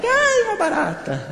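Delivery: very high, drawn-out cockroach shriek; mock scream. Preacher imitates people scared by a cockroach, mock high scream. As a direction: squeal in exaggerated fright. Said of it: "Quem já gritou com uma barata?".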